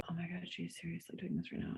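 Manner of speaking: put-on embarrassed teens. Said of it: "Olivia and Abe are like".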